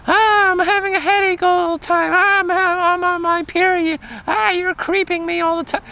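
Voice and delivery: high, put-on whining girl voice; mock whiny woman. Mocking a girlfriend's complaints, whining in a high voice. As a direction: whine like a hammed-up complaining girl.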